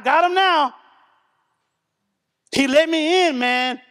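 high, sly devil voice, pleased with himself; the devil crowing over a man he got into; gloating enemy. Retold or imitated. Preacher voicing the devil gloating, high and sly.

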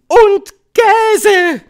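very high, very loud witch shout; piercing two-beat proclamation; calling out. A narrator's reading. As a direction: yell it as a commanding witch. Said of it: "rief die Hoch- und Großmeisterhexe".

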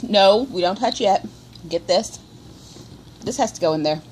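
normal talking voice

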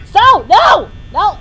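loud no; shouting. Real, not acted. Shouting at game.

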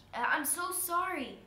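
high, loud, desperate apology; pleading apology. A narrator's reading. Stuck spider apologizes to the girl, pleading.

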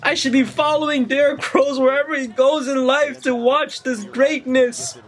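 high-pitched, overwhelmed emotional gushing; tearful joy. Real, not acted. Fan wishing he were there to watch, tearful high gushing. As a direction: gush it high, on the edge of tears. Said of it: "I'm crying watching".

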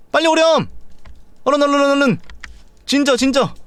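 high, urgent calling to the dog. Real, not acted. To Ginger the dog, urgent high calling out. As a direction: hurry the pet along, voice up high.

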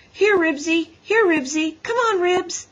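high, loud, urgent calls; boy summoning his dog at the top of his voice; calling a dog. A narrator's reading. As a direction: call the dog over eagerly. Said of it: "At least Henry's voice worked".

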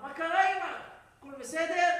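loud, high worried questions; startled awake, check on mother urgently; acted panicky concern; alarmed son. Son woken a second time.

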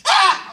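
high loud comic yelp; hammed-up terrified shriek; mock scream. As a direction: yelp like the ceiling fell. Scream during earthquake.

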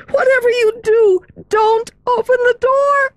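put-on high, pleading girlish voice; frightened girl pleading. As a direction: beg her, scared and urgent. Meg begs Sorelli.

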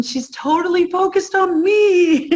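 high, laughing glee at finally getting noticed; delighted child. Pretend. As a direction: giggle it out like a thrilled little kid. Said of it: "your child is like".

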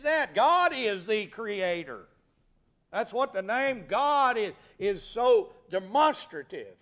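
high, loud, impassioned pulpit shout; full-force preaching. Real, not acted. Preacher to congregation declaring God the creator, full-force preaching. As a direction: build to a thundering peak on God's name.

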